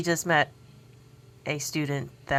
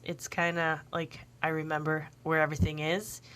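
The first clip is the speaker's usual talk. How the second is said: normal talking voice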